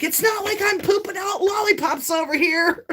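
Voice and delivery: high, laughing mock shout; mock outburst. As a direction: fling it out half-laughing. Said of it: "And I'm like".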